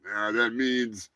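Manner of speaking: ordinary delivery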